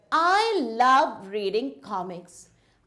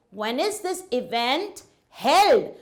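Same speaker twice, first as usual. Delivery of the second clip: slow question landing heavily on "held"; stress on HELD. Shown as a demonstration. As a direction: set "held" apart and stress it. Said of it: "My emphasis is on the word held".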